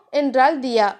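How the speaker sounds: ordinary delivery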